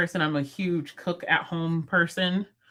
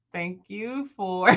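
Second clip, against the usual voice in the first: slow, careful, stretched words; halting slow-motion attempt; attempted slow delivery; slower than usual. Shown as a demonstration. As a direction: draw out each word deliberately. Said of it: "I can't say it slower".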